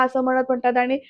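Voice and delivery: normal talking voice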